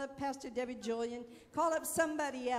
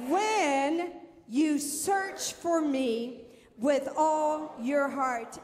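slow preaching; 5 dB louder, much slower than usual. Acted. Preacher leading congregation reading scripture.

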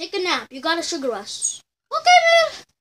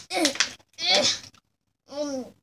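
ordinary talk

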